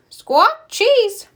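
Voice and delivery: high squawking parrot voice; loud squawk, then high bird mimicry; parrot repeating. A narrator's reading. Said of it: "Polly repeats".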